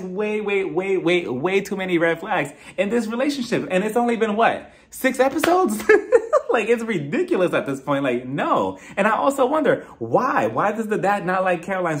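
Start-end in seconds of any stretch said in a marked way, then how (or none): 5.9-6.4 very high loud laugh; real laugh, much higher and louder; shrieking laugh. Real, not acted. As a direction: burst into a squealing laugh. Laughs at the absurdity.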